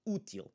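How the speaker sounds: útil as OO-teel, first syllable stressed. Shown as a demonstration. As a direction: punch the 'Ú' of 'Útil'. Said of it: "is the one that we are emphasizing".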